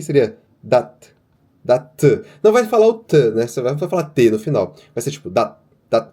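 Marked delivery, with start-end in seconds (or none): 0.0-2.2 "dat" with a short, cut-off t at the end; clipped final t. Shown as a demonstration. Teacher demonstrates the clipped "dat" ending for students. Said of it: "se você for escrever o som disso aqui". 4.9-6.1 "dat" ended abruptly, as if cut in half; cut-off syllable. Shown as a demonstration. Teacher repeats the cut-off "dat" for students. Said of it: "como se tivesse cortado a palavra no meio".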